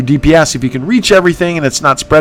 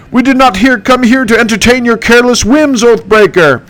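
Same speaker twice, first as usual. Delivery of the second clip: much higher, haughty heroic voice; indignant noble, pitched up; Candace voice; 8 semitones higher than usual. Acted. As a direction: hero scolding the oathbreaker, high. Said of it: "that's my impression".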